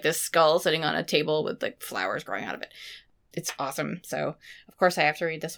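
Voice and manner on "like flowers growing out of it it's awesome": usual speaking voice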